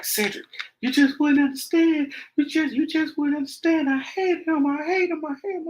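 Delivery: high-pitched imitation of a crying girl; mock boo-hoo crying. Crying girl calls him about her dad, mock boo-hoo voice. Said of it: "She boo-hoo crying".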